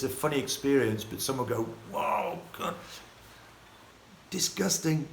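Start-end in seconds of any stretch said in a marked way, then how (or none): 1.9-5.1 imitated appalled reaction, breathy then higher; mock disgust. Imitating someone shocked by the habits, mock disgust. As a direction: recoil as an appalled onlooker would.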